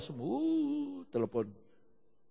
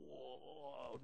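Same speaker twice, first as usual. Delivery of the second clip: usual speaking voice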